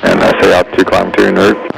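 tinny radio transmission; like a controller over a headset; crackly military radio. Real, not acted. Air traffic control over radio.

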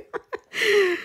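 very high, half-crying comic whine; high mock wail. Joking to viewers about avoiding jail, high mock wail. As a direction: squeal it in a laughing, high-pitched plea.

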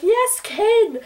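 high, excited cheer; high delighted yes; fangirl cheer. Retold or imitated. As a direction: cheer for the couple. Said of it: "I was like".

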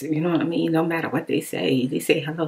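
ordinary delivery

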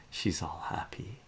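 ordinary delivery

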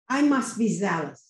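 normal talking voice

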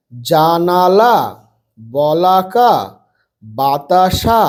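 slow, drawn-out syllable-by-syllable reading; broken into syllables. Shown as a demonstration. Teacher helping pupils with three-letter words, syllable by syllable. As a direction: stretch each syllable of জানালা, বলাকা, বাতাসা. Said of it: "ভেঙে ভেঙে পড়বে".

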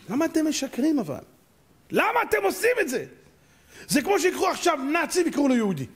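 high-pitched, pleading outrage; incredulous high wail of complaint; indignant protest. Acted.

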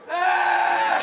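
long high piercing shriek, very loud; squirrel's endless high scream. Retold or imitated. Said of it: "A squirrel just going".

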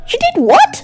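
high-pitched, indignant half yell; half-yell it, voice jumping up high. A narrator's reading. Colleague reacts to being teased about marriage, half yelling. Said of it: "she half yelled".

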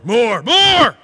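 rising greedy shout; greedy demand. Acted. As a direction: make sin sound hungry and grabbing. Preacher mimics greedy sin.

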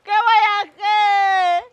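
loud, slow, drawn-out lament; despairing wail. Acted. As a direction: cry out to God in despair. Worker despairs after the jail threat.

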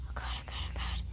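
frightened whispering under breath; scared whisper. Real, not acted. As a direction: whisper it in panic. Scared in horror game.

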